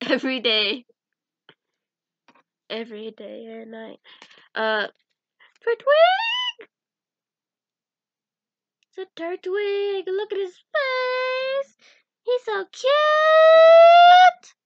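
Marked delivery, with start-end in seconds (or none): 5.6-14.5 very high, drawn-out squeal of adoration; gushing squeal. Real, not acted. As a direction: squeal louder and longer over something adorable. Gushing over a cute Turtwig.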